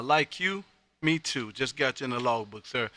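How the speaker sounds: normal talking voice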